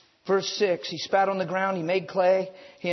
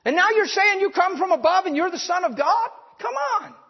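scornful townspeople; 9 semitones higher, 4 dB louder than usual. Retold or imitated. Nazareth people rejecting Jesus, high and scornful. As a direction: ridicule him like an outraged hometown crowd. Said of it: "These people in Nazareth were saying".

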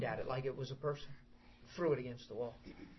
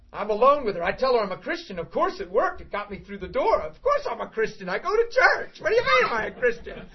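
ordinary delivery

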